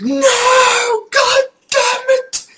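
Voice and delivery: very high, loud hammed-up wail; mock anguished yell. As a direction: replay a gamer's despairing outburst. Retells reaction to ogre destroying house.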